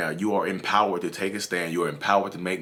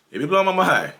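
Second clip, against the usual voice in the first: higher and louder, leaning on blowing; emphatic repeat; 8 semitones higher, 4 dB louder than usual. Real, not acted. The vlogger repeats his point to viewers, higher and louder. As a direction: punch it the second time, blowing loudest.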